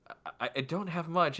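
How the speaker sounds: slightly high, soft stammer; lightly voiced hesitant, sleepless woman; weary poor woman. Sleepless woman offers payment, softly stammering in a light character voice. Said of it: "She goes".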